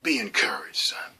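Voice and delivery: soft unvoiced whisper, imitating the pastor; whispered blessing. Remembering his pastor's words to him, whispered. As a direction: breathe it like a parting blessing. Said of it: "He would say".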